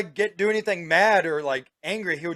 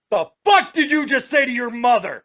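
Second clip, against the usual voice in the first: angry dad snap; 7 semitones higher, 4 dB louder than usual. Retold or imitated. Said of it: "I'm like".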